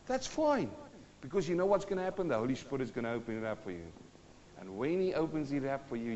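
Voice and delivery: ordinary talk, nothing marked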